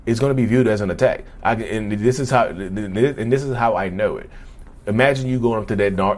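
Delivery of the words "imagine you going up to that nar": usual speaking voice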